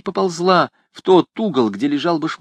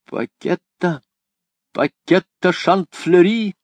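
higher, slow calling out; 8 semitones higher, 3 dB louder, slower than usual. A narrator's reading. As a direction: call the old name slowly. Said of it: "она окликнула затворницу".

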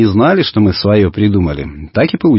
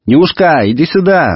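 acted boy's shout back, pitched up; mild high holler; calling back; 7 semitones higher than usual. A narrator's reading. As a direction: call to a sister across the distance, without full volume. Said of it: "закричал в ответ Иван".

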